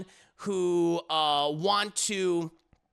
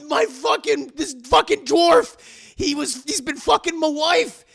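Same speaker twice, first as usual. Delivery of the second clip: loud, higher, sputtering complaint; acted indignant outrage; 7 semitones higher, 8 dB louder than usual. Husband tells friends about the affair, mock outrage. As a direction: stumble over the words in mock fury.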